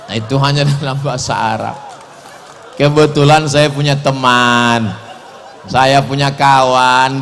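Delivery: ordinary talk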